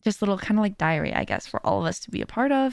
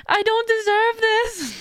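very high, drawn-out wail; mock bawling; 14 semitones higher, 3 dB louder, slower than usual. Streamer protesting to a comforting friend, mock bawling. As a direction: imitate an overwhelmed, tearful protest. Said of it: "like, bawling, and I was like".

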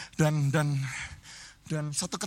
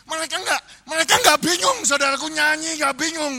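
rising rebuke; 8 semitones higher, 6 dB louder than usual. Real, not acted. Preacher rebukes congregation.

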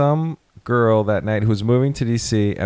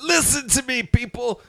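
high, booming call to the crowd; mock preacher call; 13 semitones higher than usual. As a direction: proclaim it like a preacher. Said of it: "I got up and said".

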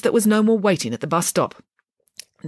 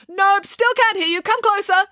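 high, loud playful taunt; high teasing voice; 4 dB louder than usual. A narrator's reading. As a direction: tease her closer, very high. Teasing Nanny Ann at door.

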